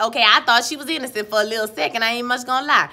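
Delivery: ordinary talk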